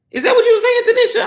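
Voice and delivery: high, loud laughing question; laughing outburst. Real, not acted. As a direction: shriek it through a laugh at a viewer. Laughing outburst at a viewer's comment.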